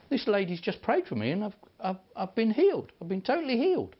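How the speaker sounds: bursting to tell the news of the healing; a retold woman, ecstatic at being healed; excited testimony. Healed woman tells supervisor.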